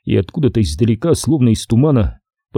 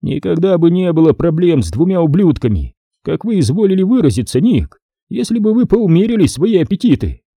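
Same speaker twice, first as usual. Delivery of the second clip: higher-pitched, indignant retort; objecting; 7 semitones higher than usual. A narrator's reading. Major blaming Nick's appetites, objecting indignantly. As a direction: push back with a raised pitch. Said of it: "возразил Стоун".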